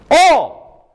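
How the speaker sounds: sudden high, loud burst on one word; emphatic shout. Real, not acted. Preacher stresses who will be persecuted, shouting. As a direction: belt out ALL from the pulpit.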